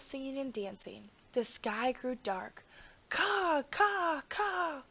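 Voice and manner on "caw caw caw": high crow cries. A narrator's reading. Huge flock of crows crying high overhead. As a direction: cry out like a dark flock of crows.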